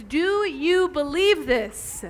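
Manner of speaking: slow, weighty question to the congregation; slow searching question. Real, not acted. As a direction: draw out each word, let it land. Preacher asks the congregation directly.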